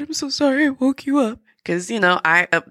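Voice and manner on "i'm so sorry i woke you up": high, small voice straining to sound okay; a thin, high apology hiding tears; forced brave apology. Retold or imitated. Mother apologizes to her daughter for waking her, high and small. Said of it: "me, the Marine that I am, was like".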